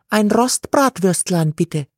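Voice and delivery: high, polite nun voice; a nun asking sweetly for a sausage; nun ordering. A narrator's reading. Nun orders a sausage at the stand, high and polite. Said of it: "Die Nonne sprach jenes Hochdeutsch".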